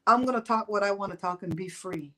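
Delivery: normal talking voice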